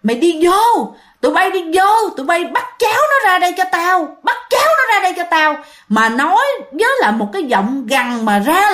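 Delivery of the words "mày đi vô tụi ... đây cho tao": high, harsh commanding growl; angry growled order. Retold or imitated. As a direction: bark the order like a furious man. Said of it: "ông này nói gằn giọng mà giống như là đang tức giận". (giọng đàn ông gằn giọng ra lệnh, tức giận)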